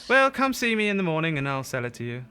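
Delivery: put-on high, loud old shopkeeper. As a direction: wrap up the deal cheerfully. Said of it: "And he says".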